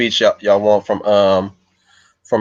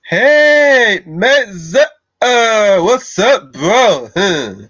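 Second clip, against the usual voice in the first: high, loud, stretched-out dude voice; Pauly Shore as Bobby; 12 semitones higher, 4 dB louder, much slower than usual. Acted. Streamer doing Bobby from A Goofy Movie, high drawn-out dude greeting. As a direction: whoop the greeting up high and let it slide down. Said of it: "I could do a Pauly Shore".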